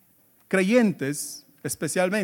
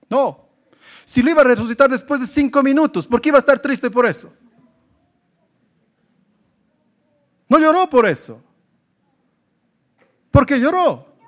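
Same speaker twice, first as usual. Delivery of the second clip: preaching at full force; 5 semitones higher, 6 dB louder than usual. Real, not acted. Preacher rejecting a wrong answer.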